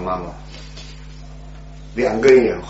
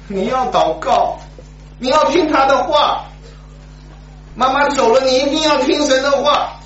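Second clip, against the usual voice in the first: pleading mother; 4 dB louder than usual. Retold or imitated. Dying mother urges her son.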